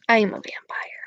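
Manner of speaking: soft hushed spooky claim; teasing spooky whisper; vampire whisper. Pretend. Repeats vampire line whispered.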